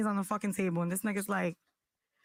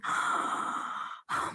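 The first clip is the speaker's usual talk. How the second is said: long, drawn-in gasp; horrified gasp. Retold or imitated. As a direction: suck in a long gasp of shock. Retells reacting to crumbled bug.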